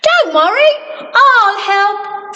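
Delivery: high, springy offer; Mister Bounce. Acted. Mister Bounce passing by offers help, high springy voice. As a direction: bounce the words up. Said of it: "said Mister Bounce".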